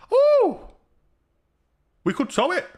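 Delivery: high, loud burst of a sudden idea; excited ooh. Real, not acted. Streamer excitedly pitching a plan to tow the car. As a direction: squeal it out like a brainwave.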